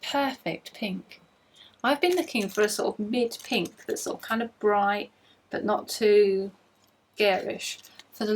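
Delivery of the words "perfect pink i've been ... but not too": normal talking voice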